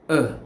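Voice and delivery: a short, relaxed neutral "uh"; schwa sound. Shown as a demonstration. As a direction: let "uh" fall out lazily and unstressed. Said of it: "We say".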